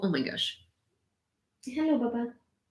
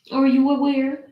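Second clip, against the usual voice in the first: higher, louder, insisting; a hammed-up demand, louder; louder insistent; 6 semitones higher, 5 dB louder than usual. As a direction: press the dog for an answer. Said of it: "And I'm just like".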